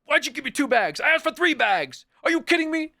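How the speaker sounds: high, loud, put-on furious complaint; hammed-up rant about the bags; mock screaming at cashier. Imagined customer screaming at a grocery cashier, high and loud. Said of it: "start screaming at people at the grocery store".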